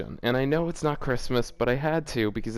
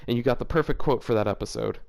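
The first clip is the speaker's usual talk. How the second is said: usual speaking voice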